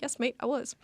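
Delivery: light Australian twang on mate; a hint of Australian accent, tossed off for fun; mock Australian accent. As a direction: play at sounding Australian. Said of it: "except for, like, mate".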